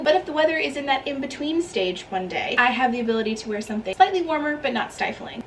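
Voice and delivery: ordinary talk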